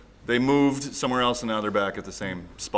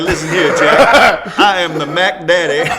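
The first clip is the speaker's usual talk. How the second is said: hammed-up swaggering pimp, much louder and higher, laughing; mock pimp boast; 7 semitones higher, 13 dB louder, slower than usual. Teasing his friend as a pimp facing the soldier, loud hammed-up swagger. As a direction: puff up and brag like a cartoon pimp through laughter. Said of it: "You were like".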